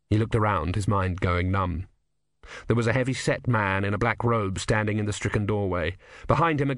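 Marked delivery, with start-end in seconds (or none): none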